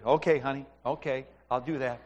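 low, soft, compliant reply; retold dutiful giving-in to a wife; meek husband. Husband meekly promising his wife.

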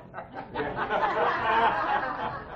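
a high airy laugh at his own joke; breathy laugh. Real, not acted. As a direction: let a soft breathy laugh slip out.

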